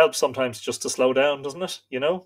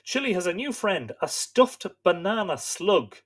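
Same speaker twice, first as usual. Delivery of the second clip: ordinary delivery, nothing marked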